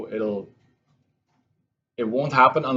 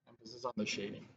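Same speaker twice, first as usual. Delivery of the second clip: much softer, quicker repeat under the breath; quiet echo; 19 dB quieter, faster than usual. Shown as a demonstration. Math teacher softly echoes her own reminder to students, quiet. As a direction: murmur the reminder again to yourself.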